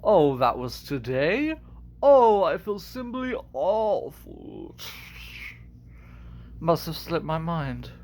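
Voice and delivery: insincere villain drawl; slow, theatrical mock apology; Scar. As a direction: feign regret with swooping pitch. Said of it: "I can kinda do Scar".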